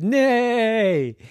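horse neigh. Acted. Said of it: "came walking by and said".

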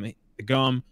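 normal talking voice

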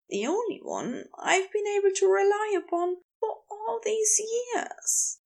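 high, plaintive character voice; high-pitched, emotional self-reproach; Aziraphale, distraught with guilt. Acted. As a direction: confess it in a high, pained voice.

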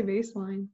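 Vaseline said as baseline with a B; mock mispronunciation. Mocking how some people say Vaseline, lightly. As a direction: swap the V for a B in baseline. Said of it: "some people say baseline".

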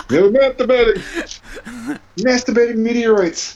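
high, slow, through laughter; laughing. Real, not acted. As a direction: giggle the words out. Cracking up mid-joke.

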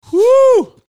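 very high, loud shout of heat; loud whoop. Real, not acted. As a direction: whoop as the spice burns. Reacting to spicy heat.

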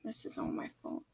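unvoiced, guilty whisper. A narrator's reading. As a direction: whisper it to yourself. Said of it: "Spew kept whispering".

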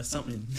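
"something" said low as "sumin", no th; dropped-g pronunciation. Retold or imitated. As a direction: softly reduce "something" to "sumin". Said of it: "I got anxious".

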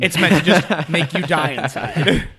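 laughing through the words; slow, loud, chuckling delivery. Real, not acted. Joking about the painful sauce.

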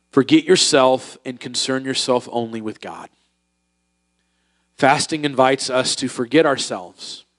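ordinary delivery

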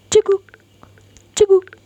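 high loud hiccup sounds; scared hiccups. Acted. Frightened boy hiccupping at shark, high. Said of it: "makin sering dia cegukan".

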